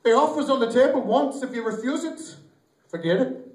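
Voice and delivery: high, insistent leprechaun. Acted. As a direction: play the little leprechaun driving a bargain. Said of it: "The leprechaun's like".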